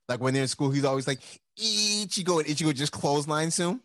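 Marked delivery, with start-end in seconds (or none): 1.6-2.4 calling out. Retold or imitated. Buddy calling out to Ichigo.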